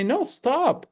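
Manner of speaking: mildly fierce. Acted. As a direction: say it as a firm refusal, without shouting. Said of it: "fierce and say".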